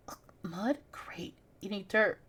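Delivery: unvoiced whisper in disgust. Character tasting mud, whispering in disgust. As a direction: breathe it out, grossed out.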